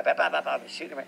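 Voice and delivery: usual speaking voice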